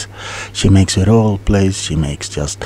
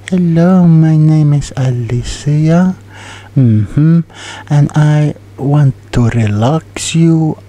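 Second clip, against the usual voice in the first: a light, bright imitation of an ASMR host's greeting, a bit higher and louder; imitating Alicia; 6 semitones higher, 4 dB louder than usual. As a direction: playfully do the Russian ASMR artist's welcome. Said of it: "I think her name is Alicia".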